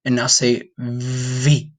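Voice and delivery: slow, deliberate cue to try the sound; slow instruction. Shown as a demonstration. Teacher guiding students through the V sound, slow. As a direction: say it slowly so they can copy. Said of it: "Put your front teeth on top of your bottom lip".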